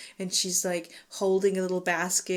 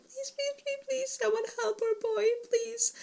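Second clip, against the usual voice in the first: high, breathy, whiny begging in a much higher voice; mock desperate pleading; 6 dB quieter than usual. Parents begging the gods to save their son, mock pleading in a much higher voice. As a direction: pray like a helpless parent, pitched way up and soft.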